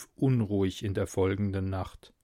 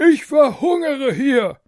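high, loud, hungry royal shout; roaring tyrant king; 13 semitones higher, 8 dB louder than usual. A narrator's reading. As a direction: bellow like a spoiled king. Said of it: "sagte der König".

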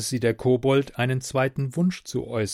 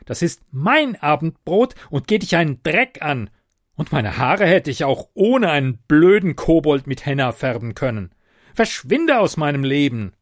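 raised, indignant telling-off; furious; 3 semitones higher, 7 dB louder than usual. Acted. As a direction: punch out an angry dismissal, higher and louder. Ute throws the kobold out. (wütend und empört)

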